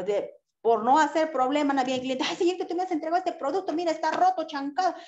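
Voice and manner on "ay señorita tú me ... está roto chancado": much higher, fast, bickering argument; mock quarrel. Customer and clerk argue over a broken product, fast and high. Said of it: "te pones a pelear con el cliente".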